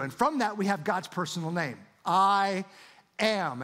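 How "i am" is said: I am, with I hit loud and held; emphatic I am. Shown as a demonstration. Preacher stressing God's name I am to the congregation. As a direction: land "I" hard, then "am". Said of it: "how do we interpret that".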